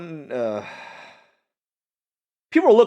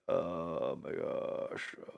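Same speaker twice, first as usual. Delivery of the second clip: groggy sigh; 5 semitones lower, 15 dB quieter, much slower than usual. Retold or imitated. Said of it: "You're like".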